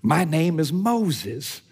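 high, slow parrot voice. Retold or imitated. Preacher's parrot answering the burglar, slow and high. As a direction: squawk it out slowly like a parrot. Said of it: "And the parrot goes".

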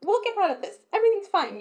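high, loud mock reassurance; forced positivity. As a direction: parody someone insisting all is well. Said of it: "you're not constantly being like".

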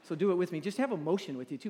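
ordinary talk

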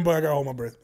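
usual speaking voice

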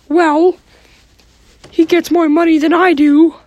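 Mickey Mouse voice. Acted. Mickey argues about Kermit.

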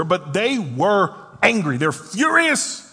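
loud, slow, climbing to a high peak; acted fury. Preacher telling church how furious the disciples were, acted fury. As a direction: build to a drawn-out outburst.

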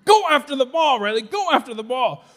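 put-on high, loud parent yelling at a child; re-enacted urgent shout from the stands; sideline yell. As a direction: holler it across the court. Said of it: "I'm yelling across the floor".

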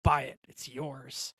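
smooth tempting devil on your shoulder. Acted. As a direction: coax like the devil. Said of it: "I'm putting on the devil voice".

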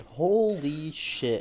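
slow, drawn-out amazement; amazed. Real, not acted. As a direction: let it stretch out in awe. Amazed at Twitter combos.

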